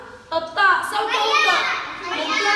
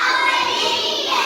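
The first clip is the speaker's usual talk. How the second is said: drawn out; 8 semitones higher, 6 dB louder, slower than usual. Shown as a demonstration. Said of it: "ط".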